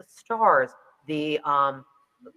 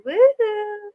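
high, loud whoop; celebratory high-pitched cheer; 5 dB louder than usual. Real, not acted. Cheering about her new book.

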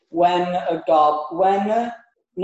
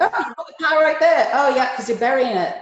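goblin voice; 8 semitones higher, 4 dB louder than usual. Acted.

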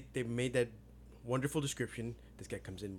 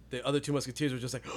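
ordinary delivery

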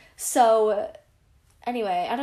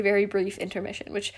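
ordinary talk

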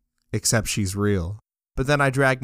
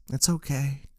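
gentle reassurance; 5 semitones higher, 6 dB quieter than usual. Acted.

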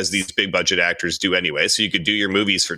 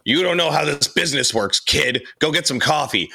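higher-pitched mock boss brush-off; condescending executive; 5 semitones higher than usual. Studio executive dismissing a young intern, condescending. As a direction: talk down to a junior as the boss.

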